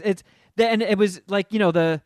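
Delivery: ordinary talk, nothing marked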